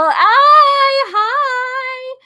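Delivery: very high, drawn-out hello; loud delighted squeal easing off; excited greeting. Real, not acted. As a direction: sing out a long, high greeting. Greeting a viewer who said hey.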